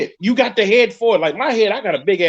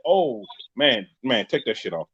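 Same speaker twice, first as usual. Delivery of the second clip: low disgusted order; 9 semitones lower, 6 dB quieter, slower than usual. Retold or imitated.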